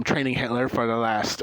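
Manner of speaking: usual speaking voice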